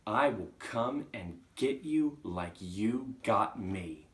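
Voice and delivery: forceful threat. Acted. Chalk repeating its threat to Agatha, slow and forceful. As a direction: drive the threat home, each word stressed.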